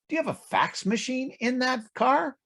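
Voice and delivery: a cheeky, nosy Texan asking across the car; voicing the Texan. Texan asks the Rolls driver.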